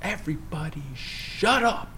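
slow, high, strained mock outburst; exasperated dad. Dad silencing his wailing boys, mock exasperation. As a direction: drag it out, imitating a fed-up father.

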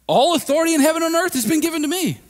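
high, loud proclaiming Jesus; voicing Jesus. Pastor voicing Jesus declaring to the church, high and loud. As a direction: lift it up as Jesus declaring his authority. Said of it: "He says".

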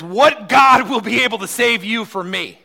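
loud, high roaring tyrant; furious king. Acted. Tyrant king roaring at the three Hebrews. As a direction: bellow the threat like a raging king. Preacher voicing Nebuchadnezzar's threat.

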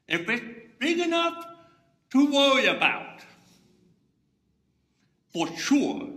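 preacher's rising emphatic cry; emphatic shout. Acted. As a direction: drive the point home with a burst. Preacher's punchline built up.

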